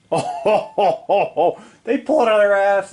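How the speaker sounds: high, crowing oh ho ho; delighted crowing at a lucky roll; gleeful whoop. Real, not acted. Gamer cheering his Scottish troops' saves, gleeful and high.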